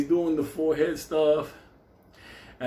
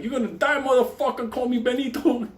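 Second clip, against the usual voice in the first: mock furious threat; 10 semitones higher than usual. Benny threatening devil.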